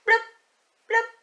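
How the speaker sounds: ordinary talk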